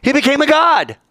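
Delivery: high, loud gasp of wonder; mock amazed crowd. The crowd marvels at Augustus's comet, mock amazed and high. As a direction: play the crowd, awestruck. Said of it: "So everybody said".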